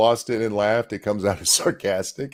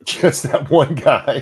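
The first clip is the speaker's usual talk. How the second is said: laughing; 3 semitones higher, 5 dB louder, slower than usual. Real, not acted. Laughing reaction to speech clip.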